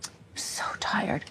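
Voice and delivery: whispered thought. Retold or imitated. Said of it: "And I thought".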